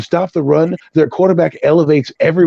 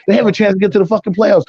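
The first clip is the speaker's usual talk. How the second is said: ordinary delivery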